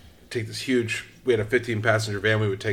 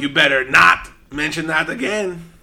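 talking goat; 5 semitones higher, 4 dB louder than usual. Retold or imitated. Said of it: "the talking goat".

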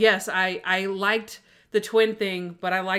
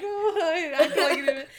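laughing mock Monk; 8 semitones higher than usual. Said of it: "And he's like".

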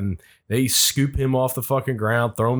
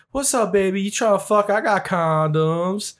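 streetwalker quote; 8 semitones higher than usual. Retold or imitated. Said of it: "She's like".

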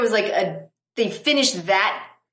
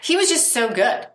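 ordinary talk

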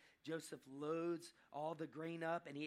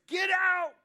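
sudden high, very loud yell; explosive shout; 18 dB louder than usual. Acted. Joseph clearing all the Egyptians from the room, sudden shout. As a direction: bellow it as if at breaking point. Joseph can't take it, orders out.

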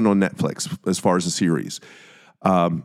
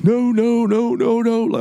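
mock panic; 13 semitones higher than usual. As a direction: imitate him panicking over what he did. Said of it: "Dahmer's freaking out".

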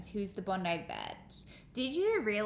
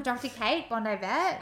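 ordinary delivery

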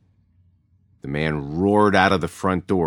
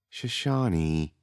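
gently reproving; 5 dB quieter, slower than usual. A narrator's reading. Mother calms her friend.

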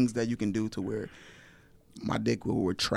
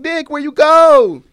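much higher, loud, slow; high squeaky call; 17 dB louder, slower than usual. Pretend. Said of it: "I'll be like".

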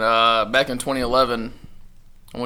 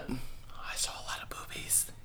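slow sly whisper; 18 dB quieter, slower than usual. Real, not acted. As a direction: breathe it like a naughty secret. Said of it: "sly aside".

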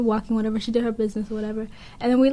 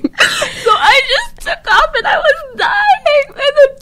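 high, drawn-out laughing voice; slow squeal of helpless amusement; cracking up; 12 semitones higher, much slower than usual. Real, not acted. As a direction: tell it through real laughter. Cracking up recalling running away.

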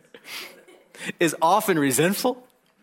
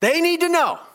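mocking outburst; 12 semitones higher, 4 dB louder than usual. Mocking the angry person.

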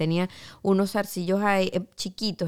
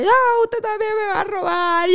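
high, louder, whimpering panic; half-crying fear; 13 semitones higher, 4 dB louder than usual. Retold or imitated. Refusing the taxi driver's ride in panic: high crying refusal. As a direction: play it near tears, pitch far up.

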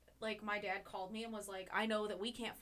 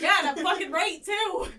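loud, high, laughing comeback; loud retort; 9 semitones higher, 16 dB louder than usual. Acted. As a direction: fire back loudly through laughter. Mock retort to parent's complaint.

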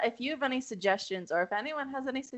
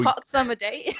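a louder Australian-sounding hot summer day; attempted Canadian, came out Australian; 4 semitones higher, 5 dB louder than usual. Real, not acted. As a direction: try for Canadian, slide into Australian. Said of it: "you went Australian".

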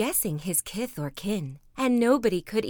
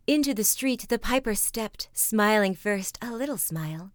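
ordinary delivery

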